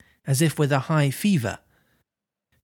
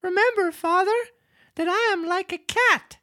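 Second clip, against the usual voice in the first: put-on joyful wooden puppet boy; jerky creaky wooden puppet. Reads Pinocchio's lines.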